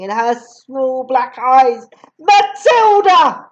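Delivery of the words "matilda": higher, louder, slow barked name. Acted. Headmistress barking at Matilda, much higher and louder. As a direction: bark it at the girl. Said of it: "she barked".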